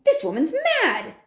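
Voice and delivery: shrill society lady scoffing; outraged wife. A narrator's reading. Missus Jenkins outraged.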